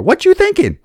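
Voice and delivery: a joking, outraged shriek; mock outraged squeal; mock incredulous. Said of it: "I haven't seen that translation".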